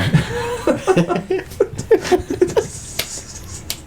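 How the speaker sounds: long, soundless wheezing laughter; wheezy laugh. Real, not acted. Podcast hosts losing it together, helpless laughter. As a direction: laugh yourself out of air.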